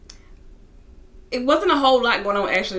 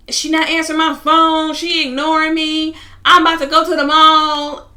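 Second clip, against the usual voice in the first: loud, high-pitched imitation of a wailing complaint; slow, loud, drawn-out tattling to mom; upset daughter complaining; 7 semitones higher, 7 dB louder than usual. Upset daughter phoning her mom about the mall, loud whining. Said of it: "Kaya's yelling".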